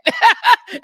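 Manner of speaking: loud high burst of laughter; real laugh, high and loud. Real, not acted. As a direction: laugh out hard at the memory. Laugh after quoting [name].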